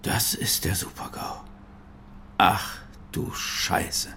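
breathless, slowed whisper of dismay; the floor drops out from under him; slow, whispered shock at the hack; stunned whisper. Acted. Discovering they have been hacked.

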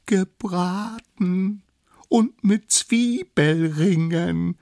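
high, very slow, drawn-out words; dreamy little lookout. Acted. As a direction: savour each word like a delicacy. Lookout fantasizes about roast mammoth. (hoch, genüsslich in die Länge gezogen)

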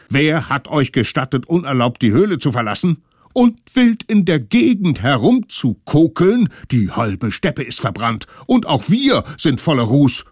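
laut, höher werdende Standpauke; schimpfend. Acted. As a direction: schimpf wie empörte Eltern. Eltern schimpfen mit den Kindern. (Eltern schimpfen laut mit den Kindern)

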